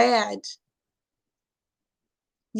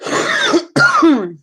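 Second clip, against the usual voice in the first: real laugh; 7 semitones higher, 3 dB louder than usual. Real, not acted. Laughing after her own joke.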